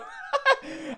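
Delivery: incredulous laugh. Real, not acted. Cracking up at the clip where he self-reports. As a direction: crack up at how dumb it is.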